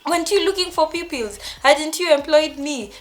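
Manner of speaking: high, loud, incredulous questions; replayed outcry of disbelief; retold desperate protest. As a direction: challenge them in rising desperation, acting it out. Acted desperate challenge on phone.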